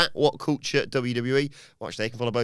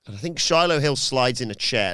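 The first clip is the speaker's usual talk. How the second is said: normal talking voice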